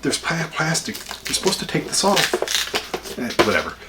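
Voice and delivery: hushed grumble about the plastic; muttered aside. Real, not acted. Fussing at packaging aside.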